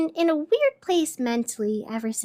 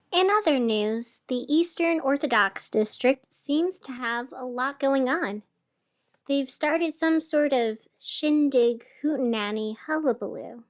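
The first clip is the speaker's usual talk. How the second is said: ordinary delivery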